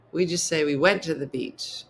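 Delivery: normal talking voice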